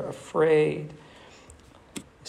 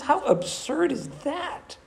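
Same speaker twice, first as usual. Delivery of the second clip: high, drawn-out disbelief; incredulous; 10 semitones higher than usual. Real, not acted. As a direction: marvel at the absurdity with a high rise. Preacher incredulous at absurd offer.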